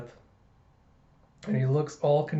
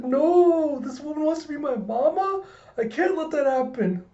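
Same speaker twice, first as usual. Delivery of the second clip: put-on horrified little girl; 12 semitones higher, 4 dB louder than usual. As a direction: play a dismayed kid protesting in a high pitch. Child voiced in horror at news.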